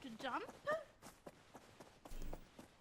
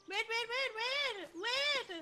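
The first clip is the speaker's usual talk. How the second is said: higher, louder alarmed pleading; panicked wait; 7 semitones higher, 5 dB louder, slower than usual. Real, not acted. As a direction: cry out in rising panic. Panicked call as car moves.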